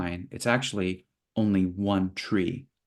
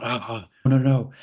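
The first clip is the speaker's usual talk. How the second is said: mock panic; 2 semitones higher, 4 dB louder than usual. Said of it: "and so you're freaking out".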